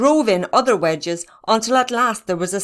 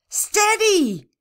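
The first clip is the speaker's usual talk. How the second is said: high, drawn-out call to ease off; urgent high-pitched caution; cried warning; 12 semitones higher than usual. A narrator's reading. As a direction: call out to slow someone down. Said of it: "cried Barney".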